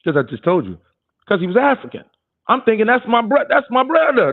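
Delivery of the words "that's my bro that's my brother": higher, excited inner thought. Retold or imitated. To himself about [name], a proud higher voice. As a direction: think it with pride, higher up. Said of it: "That's what I'm thinking".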